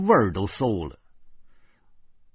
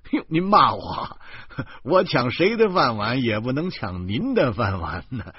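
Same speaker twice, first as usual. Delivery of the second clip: higher, exclaiming in joking protest; mock protest; 4 semitones higher than usual. Writer bantering with the policeman, mock indignant. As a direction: laugh it off with exaggerated indignation.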